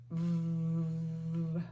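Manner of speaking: buzzing V sound held; letter sound V. Shown as a demonstration. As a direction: buzz V as a long vvv. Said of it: "is the sound V makes".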